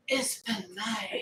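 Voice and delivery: slow, breathy whispered sultry purr; Jessica Rabbit. Acted. Echoing her partner in a sultry cartoon siren voice. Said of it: "That was like Jessica Rabbit".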